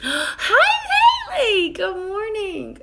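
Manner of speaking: real burst of delight at a friend joining; surprised squeal of a welcome that stretches the morning; squawked greeting. Real, not acted. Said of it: "I feel like I just squawked".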